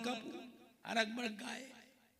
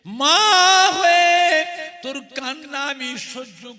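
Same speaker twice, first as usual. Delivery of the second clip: mother's anguished cry; 5 semitones higher, 16 dB louder, much slower than usual. Acted. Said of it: "ওরে মা আব্দুল উজ্জা".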